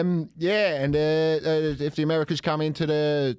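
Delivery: put-on comic Australian accent, pitch lifted; mock Australian accent. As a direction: play a belligerent leader in broad Australian. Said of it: "go for Australian".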